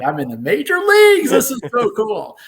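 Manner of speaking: high, loud burst of rookie glee; giddy excitement. Retold or imitated. Awestruck rookie to himself about the big leagues, giddy and loud. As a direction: gush it at full volume, way up high.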